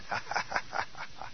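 soft breathy mock demon laugh. Preacher voicing a demon mocking him, unvoiced laugh. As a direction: let out a quiet unvoiced snicker as the demon. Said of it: "And he said".

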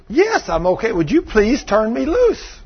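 high, impatient retold voice; exasperated plea. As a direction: imitate a put-out woman, slowing on the plea. Acting the woman demanding release.

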